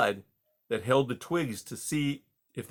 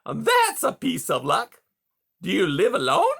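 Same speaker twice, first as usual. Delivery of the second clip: glowing beaver; 8 semitones higher, 6 dB louder than usual. A narrator's reading. Beaver delighted by Rye's answer, high and glowing. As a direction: savor the good news.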